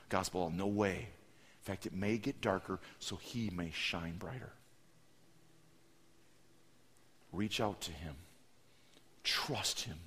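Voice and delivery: low, very soft pastoral appeal with long pauses; hushed preaching. Real, not acted. Preacher urges listeners to trust God, hushed solemn appeal. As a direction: comfort them gently and solemnly, almost murmuring.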